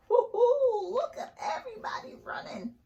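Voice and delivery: high whooping cheer, then fast laughing; high delighted whoop; excited cheer. Acted. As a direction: cheer the runners on. Cheering at picture of running kids.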